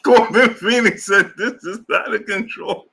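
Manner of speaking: words squeezed out through real laughter; laughing while reading. Real, not acted. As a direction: read it while you can't stop laughing. Reading chat while cracking up.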